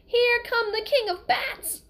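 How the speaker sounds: high, slow shrieking cry of alarm; mother's scream. Retold or imitated. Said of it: "heard his mother scream".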